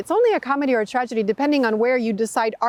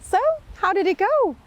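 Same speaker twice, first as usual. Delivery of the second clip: eager king; 6 semitones higher than usual. Acted. King eagerly greets servant.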